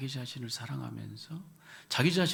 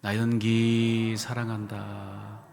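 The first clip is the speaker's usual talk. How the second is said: louder and slow, each syllable drawn out; loud slow self-affirmation; 5 dB louder, much slower than usual. Shown as a demonstration. Leading the self-hug exercise, loud and slow. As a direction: declare your own name firmly. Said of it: "여러분 성함 대면서 시작".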